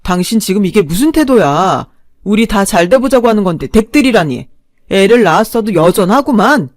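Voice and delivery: loud, rising scolding; husband scolding his wife loudly. Acted.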